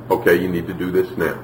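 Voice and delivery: low, quick, matter-of-fact instruction; calm. Acted. Mother telling child what to do, calmly. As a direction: state it calmly and evenly, without raising the voice. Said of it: "when she says calmly".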